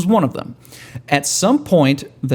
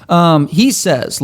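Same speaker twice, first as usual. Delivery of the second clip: ordinary talk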